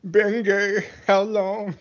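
put-on high, wheezy old geezer voice; very old man. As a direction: grumble like a cranky grandpa. Mocking old man banter.

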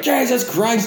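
high, startled yelp of an oath; startled exclamation. Retold or imitated. Telling a friend about bugs that move, mock startled. Startled reaction to a bug retold.

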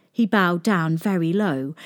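usual speaking voice

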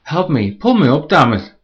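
high, very loud scream of panic and anger; furious desperate scream. Acted. Woman screaming at boyfriend who won't help, frantic yell. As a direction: scream at him to pull you up. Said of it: "she screamed".

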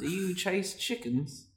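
British peasant voice; Fable chicken chaser accent. Retold or imitated. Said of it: "my Fable accent, which is the chicken chaser".

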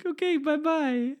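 very high, slow, sing-song doll voice; squeaky sweet doll impression; Barbie. Tour guide doll saying goodbye, high sugary voice. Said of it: "The Barbie".

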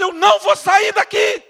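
loud, high, defiant refusal; shouting back at the policeman. Retold or imitated. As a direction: refuse to budge, loudly. Refuses guard.

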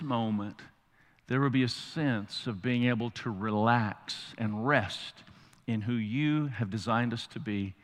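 soft, slow prayer. Real, not acted. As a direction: pray quietly and low. Prayer after breathing exercise.

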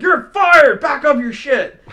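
loud, high shouted firing; mock furious shout. Coworker storming in to fake fire him, shouting. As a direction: storm in yelling. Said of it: "storm in and be like".

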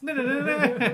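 giggling through the words; laughing. Real, not acted. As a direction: laugh while protesting. Laughing at the trailer.

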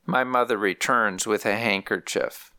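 ordinary delivery

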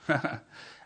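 brief amused chuckle; short laugh. Real, not acted. Preacher wrapping up the story, short laugh. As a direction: chuckle lightly to yourself.

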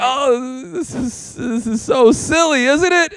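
hammed-up attempt to shrug off an embarrassing moment; rising squeaky cover for embarrassment; awkward laugh-off. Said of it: "I was like".

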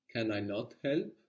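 normal talking voice

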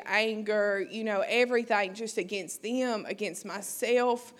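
normal talking voice

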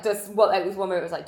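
usual speaking voice

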